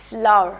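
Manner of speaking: फ्लावर said clearly for students to copy; careful model word. Shown as a demonstration. Teacher models the word flour for students. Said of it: "फ्लावर यानी आटे को कहते हैं".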